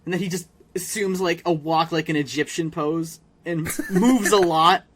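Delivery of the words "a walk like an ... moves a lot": ordinary delivery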